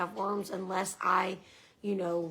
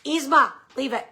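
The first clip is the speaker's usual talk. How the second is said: firm call to the dog; sharp "leave it" to a pet; stern dog command; 5 semitones higher, 9 dB louder than usual. Real, not acted. Scolding dog mid-recording.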